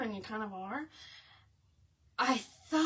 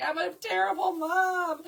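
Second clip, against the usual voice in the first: high, loud mock wail; mock crying; 8 semitones higher, 5 dB louder than usual. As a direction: lament like a distraught mom, hammed up. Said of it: "I'm crying too".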